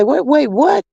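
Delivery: high, startled retelling; startled mom reacting to baby news; retold surprise. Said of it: "I say".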